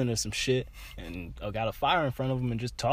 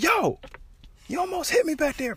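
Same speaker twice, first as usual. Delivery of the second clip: high, loud, furious yell at the driver; retold angry shout; 5 dB louder than usual. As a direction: yell it at her, heated. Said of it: "I'm hot".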